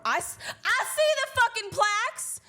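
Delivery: fake-furious squeal at the viewer; mock outrage. Mock-angry rant at viewer.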